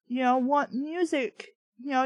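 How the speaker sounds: plain reading voice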